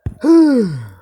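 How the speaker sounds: loud, high drawn-out sigh; big exasperated sigh. Real, not acted. Worn-out vlogger ending his rant with a loud sigh. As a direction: let out all the frustration in one sigh.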